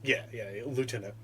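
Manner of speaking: usual speaking voice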